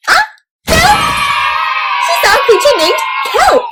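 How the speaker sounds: high, loud startled cry; mock fright. Teacher acting scared at the creature for the kids, loud mock fright. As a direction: gasp and shout at the reveal.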